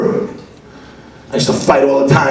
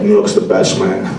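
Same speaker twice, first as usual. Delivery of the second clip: ordinary talk, nothing marked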